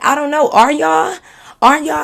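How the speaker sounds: high, loud mocking taunt; mock loud challenge. Retelling the party spat to viewers, a loud high mocking taunt. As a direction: throw the question back, loud and high. Mocking friends' sudden coldness.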